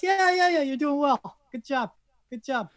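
high, breezy brush-off, yeah yeah yeah; mock patronizing. The world brushing off Canada with a high, breezy good job. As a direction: pat Canada on the head without really listening.

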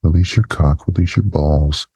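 ordinary talk

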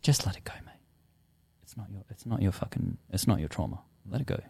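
soft whispered advice; soft hushed reassurance; gentle whisper. Retold or imitated. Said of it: "it showed me".

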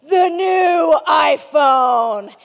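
loud, slow showman unveiling; grand product reveal. Retold or imitated. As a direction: announce it like a keynote showman. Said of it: "he'd go".

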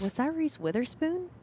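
high, incredulous question; amazed question. Retold or imitated. Girl walking out of the store spots a star, amazed.

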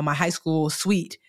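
plain reading voice

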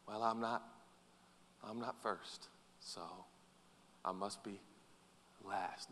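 low, soft defeated inner voice; mock self-talk. Preacher voicing a listener's self-talk, soft and low. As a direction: mutter it like a discouraged believer. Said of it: "quoted self-talk".

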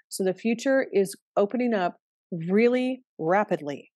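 ordinary delivery

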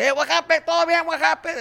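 high, loud party-uncle call; tipsy uncle. Retold or imitated. Uncles at the party calling after the running kids, high and loud. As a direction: hail the kids as they run past.

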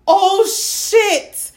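high, loud dismayed curse; shocked oh shit. Retold or imitated. Friend retelling dropping her phone, high dismayed curse. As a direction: recoil at the sight in the toilet. Phone falls in porta potty.

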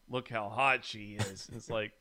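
ordinary talk, nothing marked

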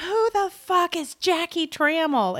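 high, indignant retold demand; angry wife. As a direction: imitate a wife demanding to know who she is. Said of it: "she was so angry".

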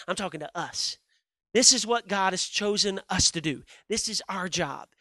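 ordinary talk, nothing marked

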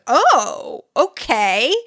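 loud, high surprised reaction; taken aback. Retold or imitated. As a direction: act out sudden realization, rising on the last word. Acts out reaction to bioweapon news.

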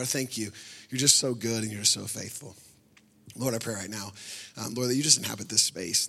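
soft, low pastoral prayer. Acted. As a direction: pray quietly over the congregation. Leads a prayer.

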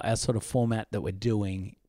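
usual speaking voice

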